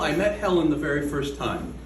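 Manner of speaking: ordinary talk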